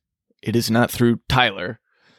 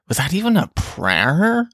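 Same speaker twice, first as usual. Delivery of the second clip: a light hint of a doubting father, rising question; incredulous dad; 6 semitones higher than usual. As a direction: imitate dad's mock disbelief. Dad questions rushed meal prayer.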